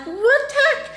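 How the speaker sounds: high-pitched mock disbelief; shocked. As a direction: squeak it out in astonishment. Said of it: "like, shocked".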